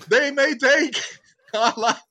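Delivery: high, loud heroic rallying cry; hammed-up war-cry oratory; mock battle speech. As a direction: declaim it to the troops, building to a long, slow final shout. Mock battle-speech movie quote.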